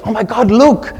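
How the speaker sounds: high, excited imitation of a friend. Friend outside the mosque spotting a sign, imitated excitement. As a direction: exclaim like a thrilled sightseer. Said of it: "suddenly she gets very excited".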